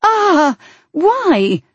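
high startled cry. Acted. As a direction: shriek it, shocked. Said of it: "crying out".

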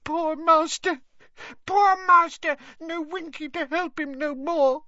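high, loud wailing elf lament; Winky cries for her master, high and tearful; tearful house-elf. Acted. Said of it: "sobbing into her skirt".

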